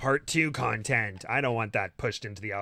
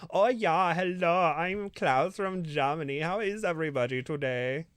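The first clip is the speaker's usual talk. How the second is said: put-on flamboyant German accent; 4 semitones higher than usual. Host showing his German accent, a flamboyant German Klaus. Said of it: "my German accent is just like the super flamboyant".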